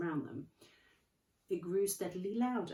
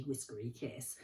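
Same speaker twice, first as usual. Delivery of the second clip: usual speaking voice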